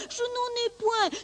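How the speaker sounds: tiny, very high, soft voice; helpless lamb's high, quiet protest; denying lamb. Acted. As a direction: deny it softly, way up high. Lamb denies in acted quarrel.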